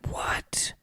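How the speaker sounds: breathy whispered What, to himself; whispered thought. Retold or imitated. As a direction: whisper it like a panicked private thought. Panicked inner thought, retold.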